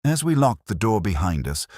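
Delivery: usual speaking voice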